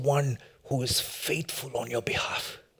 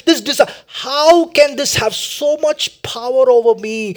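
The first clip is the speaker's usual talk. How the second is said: pleading cry to God, swelling louder and higher; anguished prayer; 7 semitones higher, 10 dB louder than usual. Acted. Sinner asking God how an idol has power, anguished prayer. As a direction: build from drawn-out lament to urgent plea. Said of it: "Oh, tell God".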